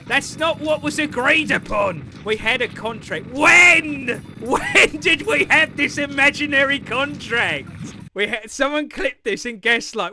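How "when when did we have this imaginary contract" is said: exasperated yell of disbelief; indignant challenge. Pretend. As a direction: demand proof in mock outrage. Disputing an imaginary contract.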